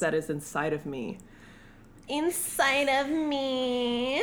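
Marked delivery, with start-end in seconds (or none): 2.1-4.2 slow, drawn-out line rising at the end; hammed-up seductive lift; porn star voice. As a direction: draw it out like a mock starlet.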